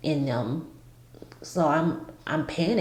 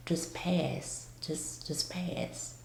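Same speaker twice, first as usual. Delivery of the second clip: very soft whisper, fading and slowing; whispered self-urging; 11 dB quieter, much slower than usual. Retold or imitated. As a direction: whisper it to yourself, barely audible. Recalls telling self to pass out.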